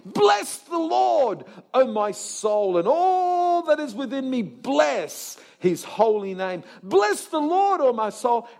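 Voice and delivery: loud, high, drawn-out preaching; full-force psalm declamation. Acted. Preacher reciting a beloved psalm to congregation, declaiming loudly. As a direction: proclaim it with long, swelling key words.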